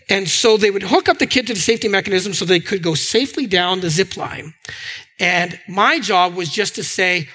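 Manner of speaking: ordinary delivery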